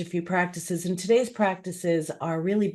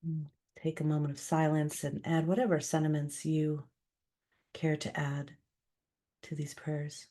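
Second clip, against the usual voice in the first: spoken prayer. Acted. Leading listeners in prayer for those suffering, calm and measured. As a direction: lead a calm, unhurried prayer.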